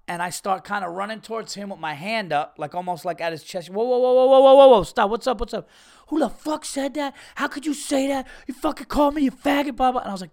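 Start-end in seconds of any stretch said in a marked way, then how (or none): 3.7-5.1 rising into loud, urgent whoas, higher each time; calling to stop. Retold or imitated. Breaking up a fight. 5.1-10.0 much higher, fired-up imitation of the hothead; angry hothead. Angry stranger voiced in story.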